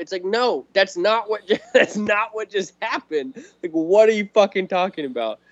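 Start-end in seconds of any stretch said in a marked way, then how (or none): none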